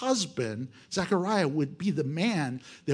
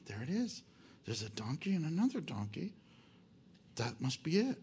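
much softer, hushed like sneaking up on a donkey; quiet sneaking; 3 semitones lower, 11 dB quieter than usual. Retold or imitated. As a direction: keep it low so the owner won't hear. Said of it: "And they go quietly".

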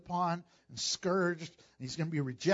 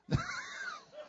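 laugh; 7 dB quieter than usual. Real, not acted. Laugh after joke.

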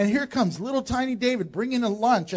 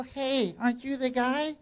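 a hint of a little girl, softer; 2 semitones higher, 7 dB quieter than usual. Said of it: "And the little girl".

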